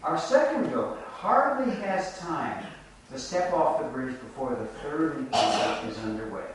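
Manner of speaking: ordinary talk, nothing marked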